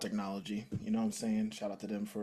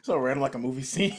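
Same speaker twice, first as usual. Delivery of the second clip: laughing; 4 semitones higher, 6 dB louder, faster than usual. Real, not acted. Laughing at a random story.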